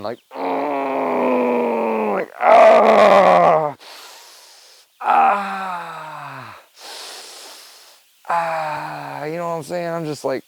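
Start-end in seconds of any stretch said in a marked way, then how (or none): 0.3-2.1 high strained grunt of pain. Retold or imitated. Lying at the bottom of the stairs, a high grunt of pain. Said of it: "just grunting". 2.4-9.2 pained groans. Retold or imitated. Hurt at the bottom of the stairs, groans fading out. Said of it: "just grunting".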